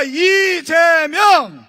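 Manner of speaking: long stretched rally chant of a name; drawn-out shout. Real, not acted. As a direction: call the name out slow and loud to the crowd. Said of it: "다시 한번 이재명 후보를 외치겠습니다".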